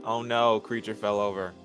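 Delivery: ordinary delivery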